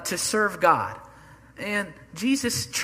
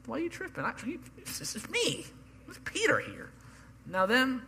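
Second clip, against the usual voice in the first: a higher, lightly voiced brash Peter; mock-cocky disciple, pitched up; Peter's boast; 7 semitones higher, 5 dB quieter than usual. Pastor imitating boastful Peter to the congregation, higher.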